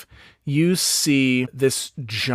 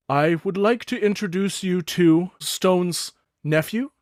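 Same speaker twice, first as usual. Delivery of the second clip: elder goblin Thook; 6 semitones higher than usual. Acted. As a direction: greet the young ones as a gentle goblin elder. Thook introduces nephew.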